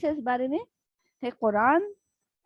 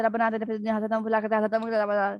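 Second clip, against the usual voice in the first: rushed prayer; faster than usual. Shown as a demonstration. Teacher shows students a rushed careless dua. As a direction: rattle the dua off fast, words swallowed. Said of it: "پورا ب-، لفظ بھی حق ادا نہیں ہوا نا".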